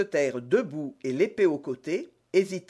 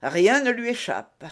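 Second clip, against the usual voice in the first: higher, louder exclamation; 7 semitones higher, 5 dB louder than usual. A narrator's reading. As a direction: exclaim with raised pitch and volume. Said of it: "s'écria Athos". (exclamation plus aiguë)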